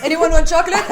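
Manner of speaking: put-on high, loud Arabic-accented offer. Daughter offering her friends chocolate, Arabic accent. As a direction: laugh through an exaggerated Arabic accent. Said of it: "like an Arabic accent".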